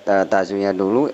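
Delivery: ordinary talk, nothing marked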